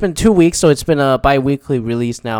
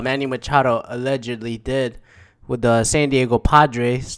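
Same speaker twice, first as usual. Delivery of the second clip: normal talking voice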